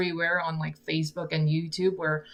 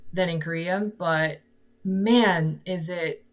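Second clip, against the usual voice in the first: ordinary talk, nothing marked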